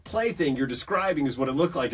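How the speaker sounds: normal talking voice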